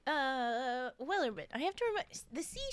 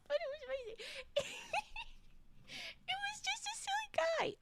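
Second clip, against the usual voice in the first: laughing; 7 dB quieter, faster than usual. Real, not acted. Laughing recalling silly voice.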